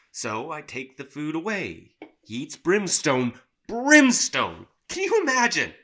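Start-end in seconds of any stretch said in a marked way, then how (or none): 2.2-5.7 high, loud exasperated outburst. Acted. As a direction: throw up your hands in disbelief. Satan exasperated about Bob.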